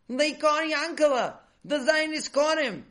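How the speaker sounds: high, loud cry of alarm; alarmed yelling. A narrator's reading. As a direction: burst in yelling the bad news. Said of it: "they yelled as they burst into".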